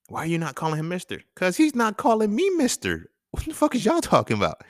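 high, acted exasperation; indignant argument. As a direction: argue back in a high-pitched voice. Retold argument over titles.

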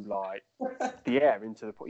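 usual speaking voice

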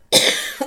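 loud hacking cough; real cough from a cold; chesty cough. Real, not acted. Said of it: "Scusate, ma ho la tosse e il raffreddore".

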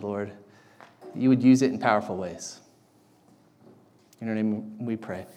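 prayer. Acted. Pastor prays before sermon.

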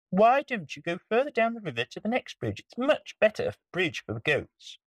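high, small pleading voice; pleading spider. Acted. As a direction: beg in a tiny high voice. Spider persuades troll.

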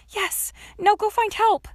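high, loud cheer of triumph; elated yell, urging on a helper; excited shout. A narrator's reading. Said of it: "She felt almost as excited".

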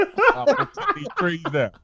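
high, laughing mock villain; cartoon villain voice. Mocking the cartoon villains to friends, high and laughing. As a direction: ham up a cartoon polluter, laughing. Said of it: "They villains are like".

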